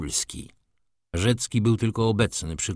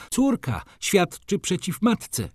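high, slow dramatic protest; baroness's outcry; 11 semitones higher, slower than usual. A narrator's reading. Baroness protests at the daughter's testimony, high outcry. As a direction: draw out the high-pitched outcry. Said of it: "krzyknęła baronowa".

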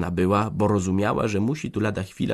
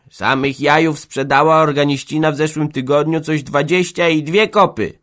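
put-on an old village woman griping about the organist, pitched up; gossiping old woman; 7 semitones higher, 6 dB louder than usual. Old woman complains to Jambroży about the organist's greed, gossiping.

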